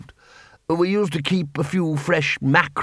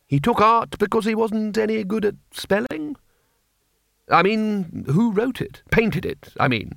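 lightly raised, bumbling wizard voice; flustered Ridcully; 8 semitones higher than usual. A narrator's reading. The Arch-Chancellor to the patrician, a lightly voiced bumbling wizard. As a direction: stammer like a confused old wizard.

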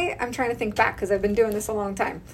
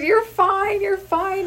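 laughing while reassuring; 9 semitones higher, slower than usual. Retold or imitated. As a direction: reassure her while cracking up. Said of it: "I was laughing my ass off".

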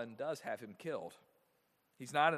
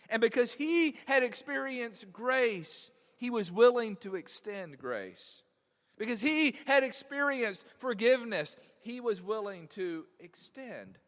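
emphatic preaching; 7 semitones higher, 6 dB louder than usual. Acted. Preaching the point home.

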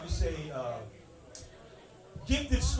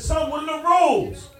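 louder stern dad; 7 semitones higher, 7 dB louder than usual. Retold or imitated.

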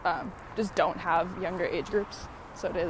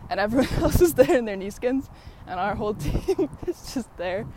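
laughing; 5 dB louder than usual. Real, not acted. Laughing at silly team suits.

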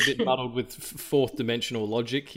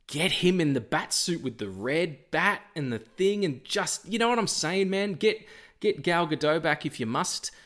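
excited; 6 semitones higher than usual. Real, not acted. Excited fan wish list.

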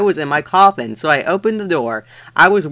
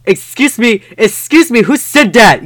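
louder, higher indignant call; indignant yell; 6 semitones higher, 5 dB louder than usual. Acted. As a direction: demand an answer, outraged. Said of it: "yelled Mister Norris".

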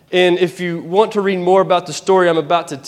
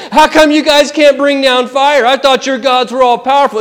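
higher, mocking jab at the prophets; mocking taunt; 5 semitones higher, 3 dB louder than usual. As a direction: needle them like a smug rival. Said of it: "Elijah began to taunt them".

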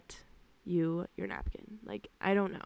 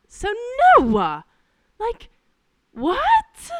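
loud, high outraged protest; outraged yelling; 4 semitones higher, 9 dB louder, slower than usual. Real, not acted. Server refusing creepy customer's photo, loud outrage. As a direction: shout your refusal in disbelief.

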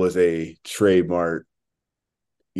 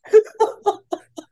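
laughing hard; 5 dB louder than usual. Real, not acted. Cracking up at co-host.

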